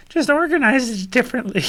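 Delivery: high cackling witch voice. Retold or imitated. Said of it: "It sounded like a witch".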